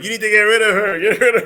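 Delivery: high, loud mock demand; hammed-up insistent wife; imitating demanding wife. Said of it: "the first wife, the was like".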